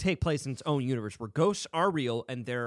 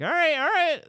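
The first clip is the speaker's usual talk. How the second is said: higher, louder all right all right; mock eager brothers; 11 semitones higher, 6 dB louder than usual. Brothers egging him on to enter the room, high and loud. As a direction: cheer him on, pitched up and loud. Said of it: "they're like".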